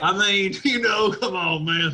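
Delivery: mock Texan protesting; Texas accent. Texan appealing to his friends, mock Texas accent. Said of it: "Turn up in Texas".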